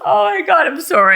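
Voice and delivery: high-pitched, recovering from a laughing fit; real giggly breathlessness, settling down; giddy apology. Real, not acted. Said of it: "I don't mean to laugh".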